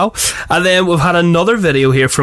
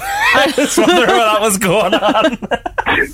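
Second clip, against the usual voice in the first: laughing; 5 semitones higher than usual. Real, not acted. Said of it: "laughing at the joke".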